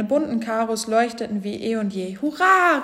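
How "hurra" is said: high, loud hooray; cheering shout. A narrator's reading. Elephants cheering as Elmar is cured, jubilant shout. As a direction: cheer it out at the top of the range. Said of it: "riefen die Elefanten".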